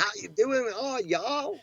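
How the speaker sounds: high-pitched mock American hillbilly; hillbilly accent. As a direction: try a Southern drawl, land on hillbilly. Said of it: "that's more of the hillbilly accent".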